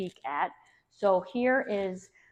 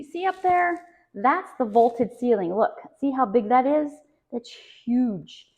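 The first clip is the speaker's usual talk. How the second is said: gushing real estate agent; 4 semitones higher, 5 dB louder than usual. Real, not acted. Agent showing off vaulted ceiling.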